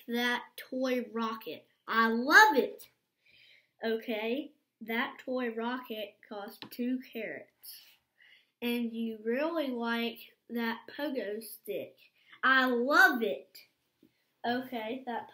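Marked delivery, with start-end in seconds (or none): 1.9-2.8 much louder, bursting with delight; excited outburst. Acted. Little bunny tells his mom about the rocket, loud and delighted. Sunny gushes over the rocket. 12.4-13.6 much louder, bursting with delight; excited outburst. Acted. Little bunny tells his mom about the rocket, loud and delighted. Sunny gushes over the pogo stick.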